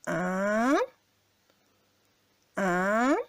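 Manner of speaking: second tone "un", short and rising. Shown as a demonstration. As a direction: let "un" climb upward. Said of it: "C'est comme quand vous dites".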